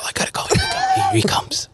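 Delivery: hurried exit in a stage whisper; imitated furtive whisper. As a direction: whisper as if about to be overheard. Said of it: "then he talks like that".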